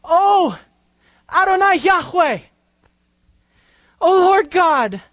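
lifted voice of worship; fervent cry to God. Acted. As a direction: call out to God in awe. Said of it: "He turns to God and he says".

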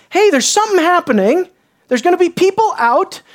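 excited elders. Acted. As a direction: act out a sudden bright idea. Acting elders spotting an opportunity.

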